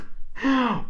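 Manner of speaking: short high laugh. Real, not acted. Teacher laughing after mixing up never and ever, real laugh. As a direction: laugh at your own slip.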